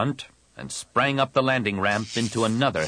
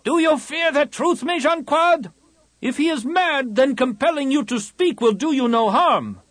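much higher, loud challenging shout; shout from below; 4 dB louder than usual. A narrator's reading. Warrior shouting a challenge at the Shaper from the crowd, very high.

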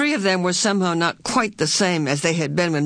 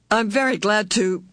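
plain reading voice

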